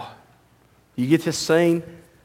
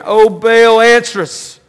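calling out; 5 semitones higher, 5 dB louder than usual. Retold or imitated. Prophets calling out to Baal.

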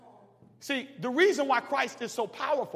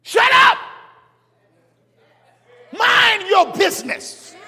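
loud, high, slow preacher's shout; full-voice pulpit rebuke; hollered rebuke; 11 semitones higher, 14 dB louder, slower than usual. Acted. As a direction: bark it at the judgers. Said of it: "why I holler when I preach".

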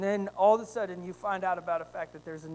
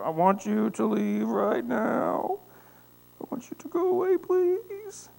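rising plea; 5 semitones higher, 6 dB louder, slower than usual. Retold or imitated. Tenant tells landlord to leave.

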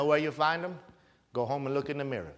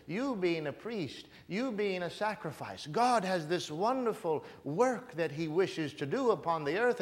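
normal talking voice

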